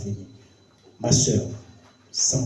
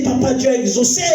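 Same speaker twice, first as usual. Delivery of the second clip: higher, shouted joy, retold; joyful cry; 11 semitones higher, 3 dB louder than usual. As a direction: cry out the good news for joy. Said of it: "elle crie de joie".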